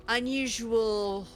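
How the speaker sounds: normal talking voice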